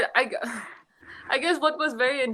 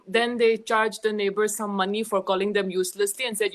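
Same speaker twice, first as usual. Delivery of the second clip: ordinary talk, nothing marked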